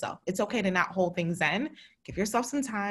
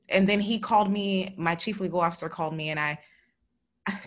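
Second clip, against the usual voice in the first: ordinary talk, nothing marked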